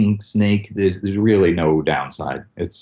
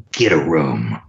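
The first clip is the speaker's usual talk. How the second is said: low gruff Snake growl in the ear; lightly voiced, low and close; 5 semitones lower than usual. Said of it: "like this".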